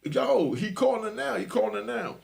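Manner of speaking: excited shout that a call came through; hallway yell. Retold or imitated. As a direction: call out to the whole unit. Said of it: "dude yelled down the hallway".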